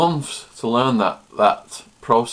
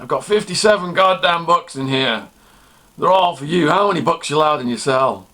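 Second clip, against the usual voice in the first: loud, high-pitched guard voice; 7 semitones higher, 4 dB louder than usual. Retold or imitated. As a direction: demand answers loudly from a prisoner. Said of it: "big redneck, chewing tobacco".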